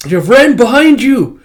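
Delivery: high, loud warning yell; shouting. Acted. A character warns of Ren's approach, shouting. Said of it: "throat's getting dry from all the shouting".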